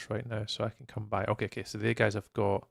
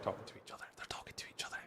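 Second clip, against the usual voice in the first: whispering; 3 semitones lower, 17 dB quieter, much faster than usual. Real, not acted. Mutters about players under breath.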